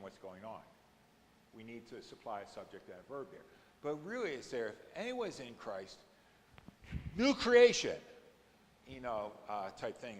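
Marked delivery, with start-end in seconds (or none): 4.7-8.0 "new creation." burst out much higher and louder; emphatic exclamation. Shown as a demonstration. Teacher showing the class the emphatic Greek, exclaiming "new creation.". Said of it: "It's this really emphatic".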